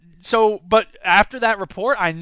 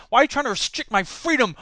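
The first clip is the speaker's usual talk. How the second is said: ordinary talk, nothing marked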